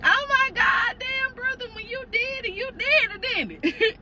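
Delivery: high, thrilled ribbing; excited teasing shout. Retold or imitated. Sister-in-law teases her brother about twins, excited shout. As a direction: shout it with delight.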